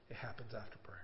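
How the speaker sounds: breathy whisper, barely voiced. Real, not acted. Preacher whispers the lesson to the congregation, hushed. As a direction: lean in and whisper the key line. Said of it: "whispered emphasis".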